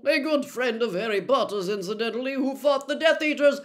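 an imperious grandmother bragging about Neville; a formidable old witch holding forth; proud old grandmother. Acted. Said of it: "said Missus Augusta Longbottom".

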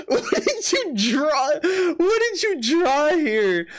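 slow, stretched-out disbelief at a weird drawing; baffled disbelief. Real, not acted. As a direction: drag out the question, completely baffled.

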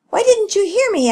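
put-on high, loud child's question; put-out pup questioning mother; asking mother. A narrator's reading. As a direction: ask it high and insistently. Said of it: "Echo asked his mother".

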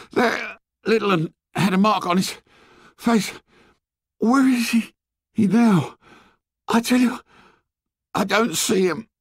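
put-on high, breathless boy in broken phrases; gasping. A narrator's reading. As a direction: force the words out between gasps, high-pitched. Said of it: "he was gasping for breath".

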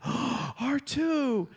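high imitation of a delighted child; thrilled little girl. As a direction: gasp the name like an amazed kid. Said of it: "and she's like".

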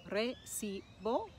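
"Recibo" slowly broken into separate syllables. Shown as a demonstration. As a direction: spell out the beats of "Recibo", unhurried. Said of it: "we put it on the penultimate".

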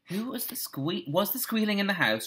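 restrained shouted question; mock shout. A narrator's reading. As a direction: call it out high and restrained. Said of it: "shouted Peter".

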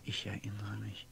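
dreamy whisper; faraway whisper. Acted. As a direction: breathe it, lost in memory. Lost in memory.

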